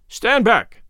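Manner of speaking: loud, high shouted warning; shouting. A narrator's reading. Narrator warning the prisoner off, loud shout. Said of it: "I shouted".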